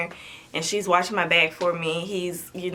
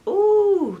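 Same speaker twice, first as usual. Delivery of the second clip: high pained ooh; 14 semitones higher, 4 dB louder than usual. Real, not acted. Lifting her baby after surgery, pained ooh. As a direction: wince at the ache.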